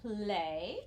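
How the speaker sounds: slow teacher demo of play; clear syllable demo. Shown as a demonstration. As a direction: play said slowly as one open syllable. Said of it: "pronounced play".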